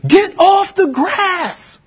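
slow, high, hammed-up scolding elder; imitated yell. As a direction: bark it like a fussy old elder guarding the lawn. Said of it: "he yelled at my".

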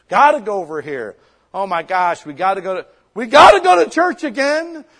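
loud, high, hammed-up complaining; mock whiny churchgoer. Pastor mocks churchgoers who dread Sunday, hammed-up whining. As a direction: whine it like church is a chore.

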